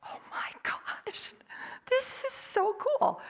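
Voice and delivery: hushed gasp, then a high, slow squeal of delight; awed whisper. Retold or imitated. Narrator to the dad on spotting the mama bunny: awed whisper rising to a squeal. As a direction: breathe it out in wonder, then let the pitch leap up.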